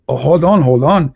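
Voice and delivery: ordinary talk, nothing marked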